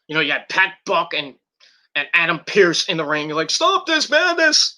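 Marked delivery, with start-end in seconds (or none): 3.5-4.7 very high, drawn-out mock plea; mock pleading official. Mimicking officials in the ring, hammed-up plea. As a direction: imitate a frantic official in a high, slow voice.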